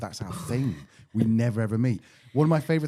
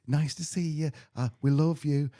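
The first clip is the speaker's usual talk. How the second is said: imitating Natalie; 4 semitones higher than usual. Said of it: "started with Natalie going".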